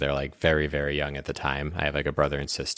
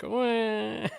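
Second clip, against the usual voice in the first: very high, soft imitation wail; mock baby cry; 5 dB quieter than usual. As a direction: squeak a tiny baby cry. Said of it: "like, a baby crying".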